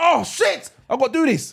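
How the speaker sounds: high-pitched, re-enacted alarm; comic, agitated blurt; retold panic. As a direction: act out a last-minute panic. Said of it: "I, I, I've, I've panicked".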